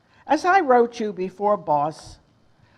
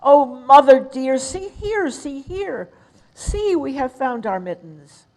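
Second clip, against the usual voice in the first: higher, excited little kitten voice; lightly voiced eager kittens; 6 semitones higher, 4 dB louder than usual. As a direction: call out happily to mother. Kittens calling to mother.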